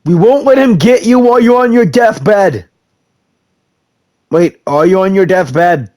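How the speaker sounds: ordinary talk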